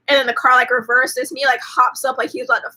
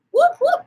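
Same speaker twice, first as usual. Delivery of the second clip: siren imitation. Cops arrive, siren.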